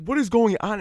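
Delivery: mom's startled high-pitched question; alarmed mom. Retold or imitated. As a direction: imitate mom asking in alarm. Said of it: "she's like".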